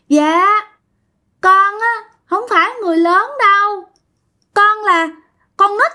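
put-on high, childlike possessed voice; high little child's voice through the aunt; child ghost voice. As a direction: speak as a small dead child.

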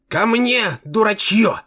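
very high, shrieking king; shrill squeal. A narrator's reading. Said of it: "пронзительно завизжал Римонендо".

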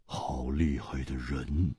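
low, soft, slow pondering; musing. Acted. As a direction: think it through aloud quietly. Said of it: "沉思着说". (姬冰燕沉思着说)

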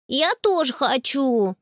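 slow, stretched whine of protest; indignant child. A narrator's reading. Jakob left out of pony riding, indignant. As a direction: protest with drawn-out words. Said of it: "возмутился Якоб".

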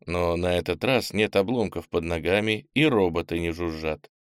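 plain reading voice

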